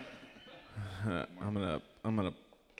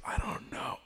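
breathy sheepish whisper; unvoiced mumble; 11 dB quieter than usual. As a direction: whisper it like you're caught. Quoting himself, whispered.

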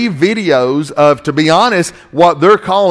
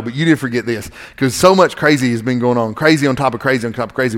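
ordinary talk, nothing marked

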